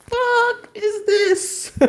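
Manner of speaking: high, stretched-out exasperated squeal; can't believe what he's watching; disbelieving squeal. Real, not acted. Fan watching a boring fight, stretched-out high squeal of disbelief.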